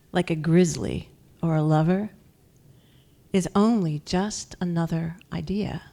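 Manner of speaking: slow, measured poem recital with long pauses; poetry reading. Acted. Poet reads her own poem to an audience, measured recital. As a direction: read it line by line, letting each phrase settle.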